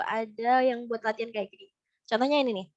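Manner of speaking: ordinary delivery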